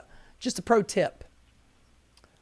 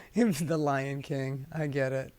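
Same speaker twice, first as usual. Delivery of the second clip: usual speaking voice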